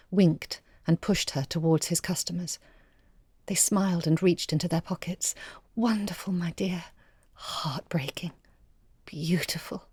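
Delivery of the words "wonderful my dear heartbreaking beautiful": hushed praise. Acted. Cafe customer praises Lily's playing in a hushed whisper. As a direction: breathe it out, deeply moved.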